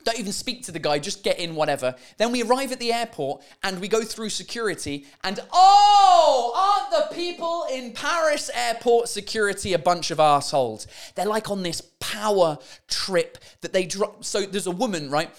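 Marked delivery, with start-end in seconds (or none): 5.5-10.9 high, loud burst of exasperation; exasperated rant. Real, not acted. Ranting to viewers about Paris airport security, exasperated. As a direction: throw up your hands at them.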